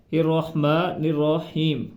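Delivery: slow, with را and ہا joined in الرحمن; drawn-out الرحمن الرحیم, letters linked; slow careful pronunciation. Shown as a demonstration. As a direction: join را to ہا in الرحمن, slowly. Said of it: "را کو ہا کے ساتھ ملانا ہے".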